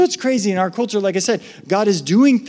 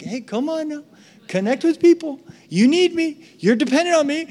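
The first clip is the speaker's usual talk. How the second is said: gentle God; 10 semitones higher than usual. Retold or imitated. God voiced high.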